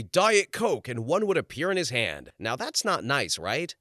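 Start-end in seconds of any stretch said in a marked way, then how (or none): 0.0-0.9 high, loud imperious demand. Retold or imitated. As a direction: imitate a star ordering without a please. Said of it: "[name] would go like this and he would say".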